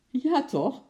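high, loud amused retort; laughing reply. A narrator's reading. Baker laughs at the disbelieving reply, high and amused. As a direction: answer back with amusement. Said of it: "lachte de bakker".

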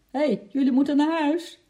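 loud, high shout to the little ones; calling out. A narrator's reading. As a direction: call them home, high and loud. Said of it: "riep ze".

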